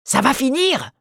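high, sharp furious cry; furious shout. A narrator's reading. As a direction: slam it down with the fist. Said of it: "cria furieusement".

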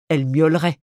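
plain reading voice

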